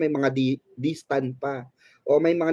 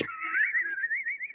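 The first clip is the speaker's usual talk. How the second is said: squealing high laugh; 11 dB quieter than usual. Real, not acted. Laughs at comment. (matinis na tawa)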